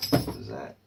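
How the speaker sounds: deep growled question; low loud voice. Pretend. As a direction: drop the voice way down. Repeats phrase in different voices.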